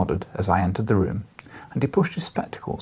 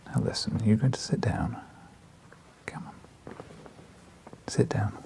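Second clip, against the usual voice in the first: hushed, gentle command to a pet; coaxing whisper; 8 dB quieter than usual. Real, not acted. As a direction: softly coax the dog to sit. Coaxing the dog to sit.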